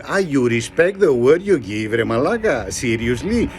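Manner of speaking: ordinary delivery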